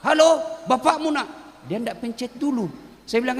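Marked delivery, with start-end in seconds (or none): 0.0-1.3 mock phone yell. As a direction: call out like an old man on a phone. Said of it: "dia teriak".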